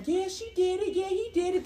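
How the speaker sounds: high, soft mocking voice; lightened tattletale chant; mimicked tattletales. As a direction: imitate a crowd of snitches, small and high. Mimics everybody telling on her.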